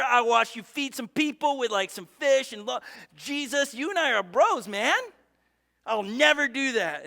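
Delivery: loud, brash boasting, chest puffed out; boastful chest-puffing bro. Retold or imitated. Peter boasting loudly to Jesus, chest puffed out. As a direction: brag like a cocky buddy who can't fail. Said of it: "Peter puffed out his chest".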